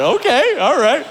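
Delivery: high laughing okay; awkward laugh. Retold or imitated. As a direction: brush it off laughing. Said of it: "I said".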